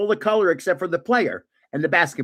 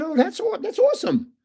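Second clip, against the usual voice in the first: retold, much higher and bright with enthusiasm; delighted; 12 semitones higher than usual. Reacting to her soccer past, delighted. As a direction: gush over the news. Hears she played varsity soccer.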